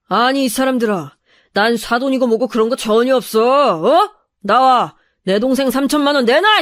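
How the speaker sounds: menacing thug brother bellowing demands; rowdy intimidating shouts; yelling. Acted. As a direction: throw your weight around, loud at the phrase ends. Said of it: "목소리로 소리를 고래고래 질렀어요".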